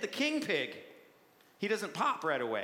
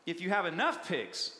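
usual speaking voice

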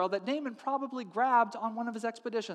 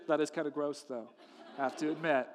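ordinary talk, nothing marked

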